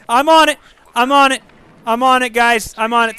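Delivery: high, loud repeated assurance; hurried calling back. Real, not acted. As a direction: call it out urgently. Calling back to urging viewers.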